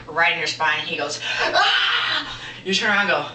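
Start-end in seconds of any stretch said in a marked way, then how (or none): 1.4-2.4 high, loud mock scream; mock yelp. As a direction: yelp sharply as the attacker. Imitated hitter's cry in retelling.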